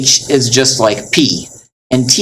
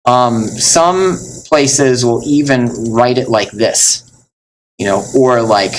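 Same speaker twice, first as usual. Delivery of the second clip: normal talking voice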